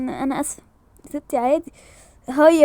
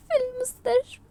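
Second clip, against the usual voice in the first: high, crying answer; upset, high-pitched sob; tearful; 8 semitones higher, 4 dB quieter than usual. Acted. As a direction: squeeze it out high through tears. Tearfully tells of hospital.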